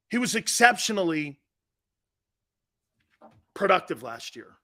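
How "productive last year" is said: ordinary talk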